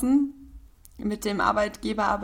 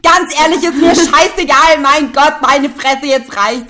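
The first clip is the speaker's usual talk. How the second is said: higher, louder fake rant; mock exasperated outburst; 4 semitones higher, 12 dB louder, slower than usual. Fed up with the napkin talk, loud mock rant. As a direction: blow up in hammed-up frustration.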